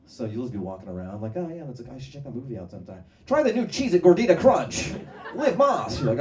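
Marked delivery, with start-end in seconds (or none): 1.4-6.0 casual chat that flips into a loud ad read; ad-riddled brain voice. Pretend. Free Neuralink user blurting an ad. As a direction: blurt out an ad mid-sentence.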